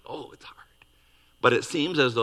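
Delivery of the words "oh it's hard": soft hushed aside about silence; whisper. Pretend. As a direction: breathe it like a secret. Talking about quietness.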